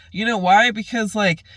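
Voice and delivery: high, loud upset protest; heated self-defence. Retold or imitated. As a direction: insist on it, worked up. Defending self, close to breaking.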